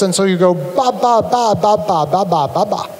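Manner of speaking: repeated voiced "ba, ba" syllables like a babbling baby; playful baby-babble demo of "ba". Shown as a demonstration. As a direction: babble "ba" over and over with lips together. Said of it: "buzzing sound in your voice box as you expel it past your lips".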